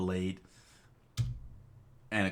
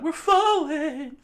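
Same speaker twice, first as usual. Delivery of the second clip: very high, loud, slow wail; high panicked scream; 10 dB louder, slower than usual. Acted. Chikara plunging to the water, high panicked scream. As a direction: wail it as you fall. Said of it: "Chikara screamed out".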